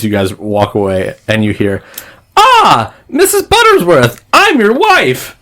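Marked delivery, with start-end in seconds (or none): 2.4-5.4 very high, loud squeal; piercing falsetto announcement; screeched greeting. Acted. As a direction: shriek it like a box toy popping open. Game master voices springing-open box.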